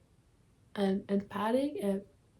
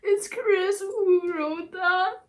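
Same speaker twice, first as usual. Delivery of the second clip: high, slow tearful words; crying, pitched high and stretched; real crying; 9 semitones higher, much slower than usual. Real, not acted. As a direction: sob out the realization, drawn out. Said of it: "I'm really crying".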